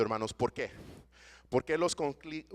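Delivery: ordinary delivery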